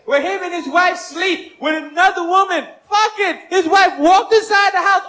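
normal talking voice

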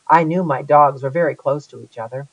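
plain reading voice

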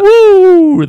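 high, loud wrestler's Woo!; Ric Flair woo. Retold or imitated. Naming Ric Flair, the Nature Boy.

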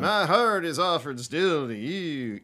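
high, slow, loud singer parody with swooping pitch; drawn-out, wavering mock folk-singer delivery. Shown as a demonstration. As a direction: stretch and swoop each word like a folk-singer send-up. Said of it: "we would Bob Dylanize everything".

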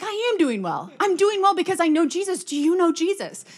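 high, loud burst of eager conviction; exuberant testimony. Retold or imitated. Acted exuberant testimony to store clerk.

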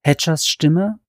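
plain reading voice

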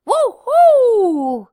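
loud, high joyful whoop; relieved cheer. Acted. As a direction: whoop with relief after escaping. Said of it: "said Sheru".

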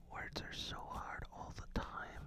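soft unvoiced whisper; breathy whispered aside. Real, not acted. Whispered aside while reading.

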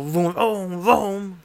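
loud revving vroom noises; playful engine noise. Retold or imitated. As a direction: rev it up with your mouth. Imitating the engine.

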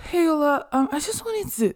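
high-pitched hesitant asking; imitated coursemate. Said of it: "and she was like".

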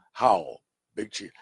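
mock chief voice. Joking example of speech without articles, mock chief voice. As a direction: put on a low, stilted chief voice. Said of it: "Kinda like an Indian chief".

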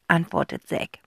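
usual speaking voice

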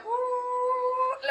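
high mock dreamy "uh"; dreamy moan. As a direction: sigh as if in a dream car. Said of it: "me estoy teletransportando".

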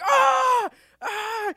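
very high, drawn-out screaming outrage; freaking out. Retold or imitated. Retelling a man attacked in a movie, high hammed-up scream. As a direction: scream it in mock agony at full volume. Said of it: "freaking out".

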